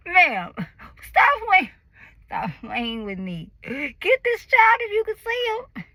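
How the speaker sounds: high, loud, exasperated demand; serious demand. Retold or imitated. Mother in labor scolding the nurse, exasperated demand. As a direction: tell the nurse to stop playing. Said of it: "but I was serious".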